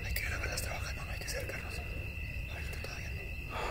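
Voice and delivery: soft hushed whisper; whispering while hiding. Real, not acted. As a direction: whisper quietly. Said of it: "No, no puedo hablar fuerte".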